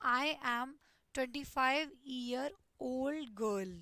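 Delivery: very slow, drawn-out words; slow dictation of the contrast sentence. Shown as a demonstration. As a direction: read out the second option slowly. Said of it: "दूसरा".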